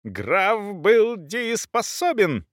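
high, slow indignant protest; outraged high cry, drawn out without full volume; crying out angrily. A narrator's reading. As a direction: insist on every word, voice pitched high. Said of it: "закричала Брунхильда".

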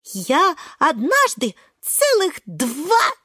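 high, drawn-out, emphatic boast; worked up. A narrator's reading. Шпинатик telling of his two-hour test, high and emphatic. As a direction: stretch each word in high-pitched excitement. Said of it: "подскакивает Шпинатик".

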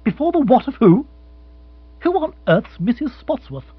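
high, incredulous questioning; flabbergasted exclamation; baffled surprise. Acted. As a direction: demand to know, utterly puzzled. Bill baffled by unknown name.

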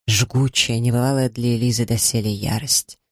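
usual speaking voice

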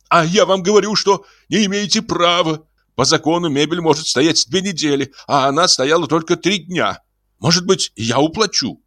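high, fast, pleading chatter. Acted. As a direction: run after him begging. Iznurenkov chases Ostap pleading. (высокий торопливый умоляющий голос)

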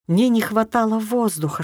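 plain reading voice

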